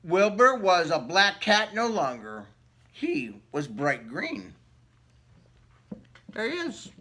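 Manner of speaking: usual speaking voice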